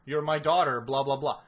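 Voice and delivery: lightly voiced mocking Raven. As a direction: brush it off, laughing.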